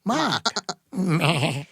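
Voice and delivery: exaggerated, badly faked Boston "Mark"; terrible Boston accent. Teasing a co-host who mocked the accent, in a terrible Boston accent. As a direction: overdo a bad Boston accent with no R. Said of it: "That accent".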